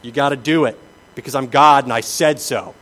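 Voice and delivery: bossy God voice. Retold or imitated. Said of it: "it's not simply".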